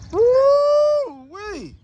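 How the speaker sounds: very high, long whoop; real delight, sung out high; excited woo-wee. Real, not acted. Car dropped onto new wheels.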